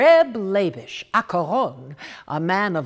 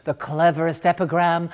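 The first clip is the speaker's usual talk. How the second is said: ordinary delivery, nothing marked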